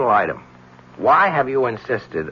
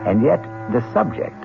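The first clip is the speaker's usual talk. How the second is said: measured scene-setting narration over music; old-time radio narrator opening an act; radio drama narration. A narrator's reading. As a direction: paint the scene for listeners, with a pointed lift on witchcraft.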